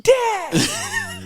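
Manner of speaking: put-on high, loud kid's holler; excited yell. Son bolting to the car calls for Dad, yelling loud. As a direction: yell it out like an overjoyed child. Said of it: "yelling, yelling loud as hell".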